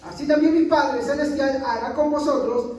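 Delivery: scripture reading. Acted. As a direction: read it out to the congregation like a preacher. Reads Mateo 18 aloud.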